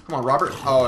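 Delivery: ordinary talk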